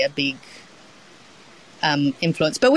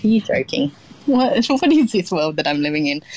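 higher-pitched, incredulous with a laugh; retold disbelief; 5 semitones higher than usual. Young cook to chef who doesn't know laksa, incredulous. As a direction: act out your astonished comeback. Acting her disbelief at chef's question.

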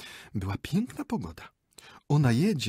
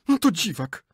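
higher, louder huffy dismissal; indignant scoff; 10 semitones higher, 9 dB louder than usual. Acted. Lady scoffs at her jealous husband, high and indignant. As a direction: scoff at him, offended. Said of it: "oburzyła się pani".

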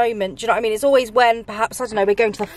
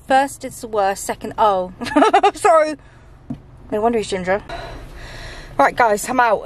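usual speaking voice

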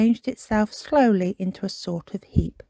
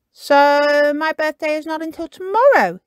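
Kipper working it out; 8 semitones higher, 5 dB louder than usual. Acted. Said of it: "he said".

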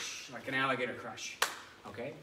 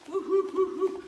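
very high, loud monkey calls; monkey hoots; 11 dB louder, slower than usual. Shown as a demonstration. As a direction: whoop like a monkey. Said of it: "make a monkey noise go hoo".

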